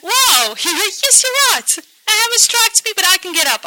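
high, loud, eager wow with a laugh; light up at the advice; delighted realization. Retold or imitated. Recalling her reaction to Zoe's advice, high and delighted.

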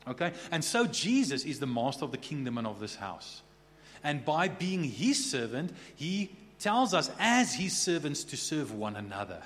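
normal talking voice

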